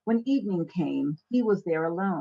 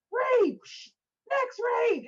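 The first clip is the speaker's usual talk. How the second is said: spraying frantically; slower than usual. Retold or imitated. Spraying bugs in a panic.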